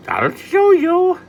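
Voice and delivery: high, loud, slow leprechaun; a tiny leprechaun promising trouble; threatening leprechaun. Acted.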